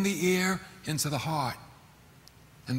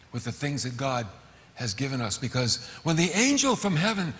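ordinary talk

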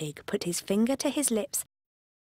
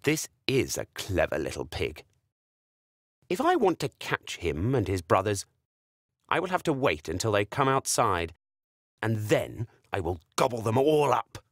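very low plotting wolf; scheming wolf; 9 semitones lower than usual. Acted. Wolf scheming to himself, very low. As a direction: plot it low to yourself.

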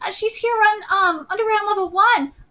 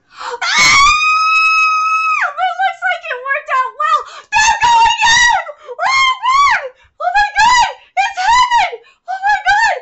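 much higher, loud joyful shrieks; ecstatic scream; 11 semitones higher, 6 dB louder than usual. Real, not acted. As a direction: squeal like your ship just sailed. Fan watches couple go in.

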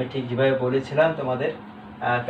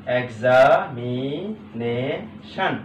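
"Examination" very slowly split into drawn-out syllables; much slower than usual. Shown as a demonstration. Teacher dividing a long word for students, syllable by syllable. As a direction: break "examination" into its parts, stretching each. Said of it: "ভাগ করা অত্যন্ত".

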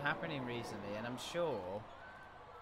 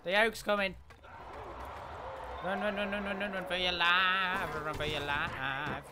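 high, loud panicked yelling; being chased; 4 semitones higher, 5 dB louder than usual. Real, not acted. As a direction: yell like something is right behind you. Fleeing an enemy in game.

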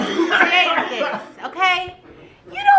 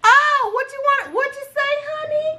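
mock sweet teasing; 9 semitones higher than usual. Teasing him as he gags.

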